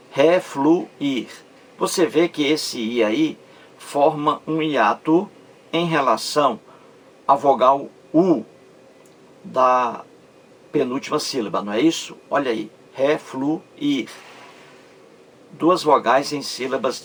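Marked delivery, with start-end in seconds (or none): 0.1-1.3 REFLUIR split into separate syllables, re - flu - ir. Shown as a demonstration. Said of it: "Olha a separação silábica aí". 12.9-14.1 slow "refluir", split as re - flu - ir; syllable by syllable. Shown as a demonstration. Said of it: "Olha a separação silábica aí".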